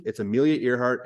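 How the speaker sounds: normal talking voice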